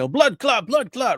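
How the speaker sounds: high mock Jamaican shout of blood clot; Jamaican accent. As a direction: imitate the Jamaican movie villains, high and loud. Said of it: "They say".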